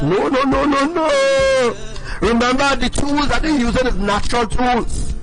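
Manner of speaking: usual speaking voice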